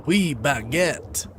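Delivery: hammed-up French accent, slow on the last words; mock French accent. Gamer clowning a fake French accent for viewers. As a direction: play the office's go-to Frenchman, badly. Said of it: "who can do a French accent".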